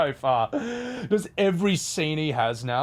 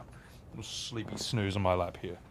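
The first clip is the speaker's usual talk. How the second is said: softer, doting murmur; soft cooing; 3 semitones lower, 10 dB quieter than usual. Real, not acted. Owner cooing over his cat on his lap, soft doting murmur. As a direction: dote on the sleeping cat.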